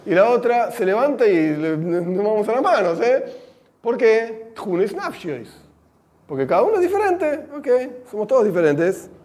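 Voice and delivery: normal talking voice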